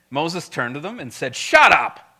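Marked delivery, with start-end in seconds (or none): 1.4-2.1 loud, curt command; blunt rebuke. Acted. Preacher as Moses turning to the people, blunt rebuke. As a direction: cut them off hard and loud. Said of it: "That's the tone that's happening".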